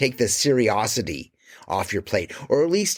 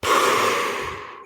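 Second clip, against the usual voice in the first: long audible exhale; slow demo sigh; deep breath out. Shown as a demonstration. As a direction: show the calming breath. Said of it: "take a deep breath and go".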